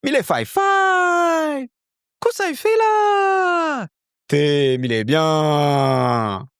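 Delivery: high, slow, exaggerated Reunion Creole accent. Imitating a local's sing-song Reunion Creole accent. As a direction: shout it high and drawn out like an island local. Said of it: "there's different accents".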